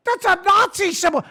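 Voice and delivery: high-pitched mock accusation; mocking leftist. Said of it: "some leftist is like".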